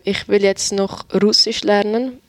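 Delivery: ordinary talk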